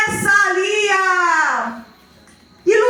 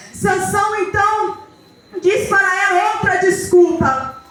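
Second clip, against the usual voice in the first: ordinary delivery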